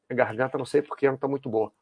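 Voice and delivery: just after a sip of water; while sipping a drink. Real, not acted. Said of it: "deixa eu tomar um gole d'água que hoje".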